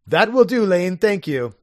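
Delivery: mock lordly gentleman; Algernon.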